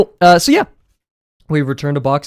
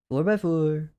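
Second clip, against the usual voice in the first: mild character voice; 4 semitones higher, 6 dB quieter, slower than usual. Retold or imitated. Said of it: "I can't do his voice".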